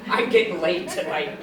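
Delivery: low, sultry inner thought. Retold or imitated. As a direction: purr it like a seductress. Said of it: "And I'm thinking".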